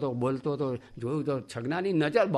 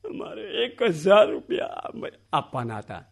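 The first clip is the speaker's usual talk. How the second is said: mock wailing; 3 semitones higher, 3 dB louder than usual. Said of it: "રડવા માંડ્યો".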